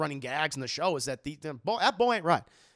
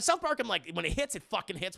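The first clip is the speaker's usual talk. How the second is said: ordinary delivery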